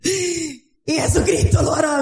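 high, fervent preaching cry; preaching at full force. Acted. Preacher rousing the congregation, fervent high cry. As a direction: lift it up and preach it to the church.

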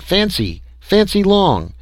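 high, wondering call to her; stunned calling. A narrator's reading. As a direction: call her name in disbelief. Stunned man calls to vision.

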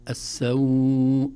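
loud السوء with a long held aw; medium madd. Shown as a demonstration. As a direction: chant السوء slowly, stretching the aw. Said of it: "هكذا يسمى مد اللين".

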